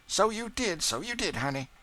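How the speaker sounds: put-on high, doting old cook; fond Aunt Chloe. A narrator's reading. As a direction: coo over the boy. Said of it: "said Aunt Chloe".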